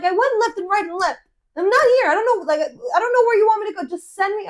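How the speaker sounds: high, loud re-enacted frustration; exasperated retort. Lost driver answering friend's directions, exasperated. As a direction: argue back at the top of your range. Acted phone quarrel, driver snaps back.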